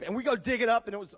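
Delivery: normal talking voice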